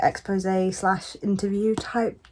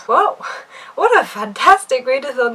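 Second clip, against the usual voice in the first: high, loud, amazed "Whoa"; 4 semitones higher, 6 dB louder than usual. Real, not acted. Vlogger amazed by her own readathon ratings. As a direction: burst out in real amazement.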